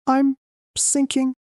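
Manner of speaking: high, slow, distant ghostly voice; a drowned voice from far away; eerie underwater voice. Acted. A strange voice reaches the fainting mother, eerie and distant. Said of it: "sounds like I'm under water".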